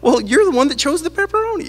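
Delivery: high, mock-incredulous retort; imitating a fed-up adult pointing it out; exasperated reply. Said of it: "it was like".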